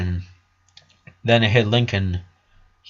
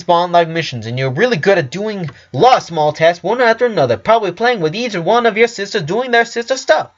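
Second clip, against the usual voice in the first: a higher, quick know-it-all doctor explaining a diagnosis; brisk doctor; 5 semitones higher, faster than usual. Acted. Doctor explaining to Lincoln, higher and brisk. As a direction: lecture the boy like a smug physician.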